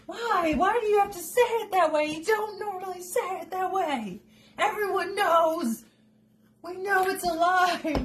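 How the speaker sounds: high, whiny-comic protest; exasperated pleading. Acted. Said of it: "And you're like".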